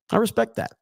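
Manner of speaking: respect lifted high. Shown as a demonstration. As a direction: ripetere respect più acuto, con finta serietà.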